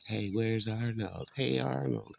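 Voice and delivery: lightly voiced cartoon kid calling out; a light playful cartoon voice calling for a friend. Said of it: "I play Arnold".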